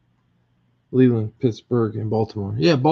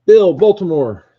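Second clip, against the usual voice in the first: louder, higher call across the room; 7 semitones higher, 9 dB louder than usual. Real, not acted. Host calling out to Bill across the room, loud and high. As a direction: sing out the name to grab Bill's attention.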